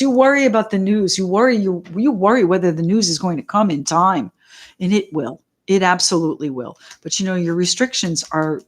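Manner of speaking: usual speaking voice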